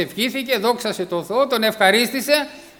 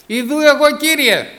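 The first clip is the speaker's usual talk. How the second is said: loud and high, Ιδού εγώ thrown out; eager answer; 5 semitones higher, 7 dB louder than usual. Shown as a demonstration. Samuel answering God, eager and loud. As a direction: answer the call with full voice. Preacher models Samuel's reply.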